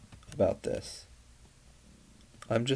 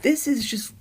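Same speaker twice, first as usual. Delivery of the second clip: much higher and louder, shouting in disbelief; outraged shout; 13 semitones higher, 5 dB louder than usual. Real, not acted. Reacting to his underwear picture, a high outraged shout. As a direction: yell it like you can't believe your eyes.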